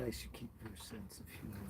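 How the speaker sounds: very soft, low mumble; reading to himself. Real, not acted. Streamer reading a viewer's comment to himself, very quietly. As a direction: read it under your breath, barely audible.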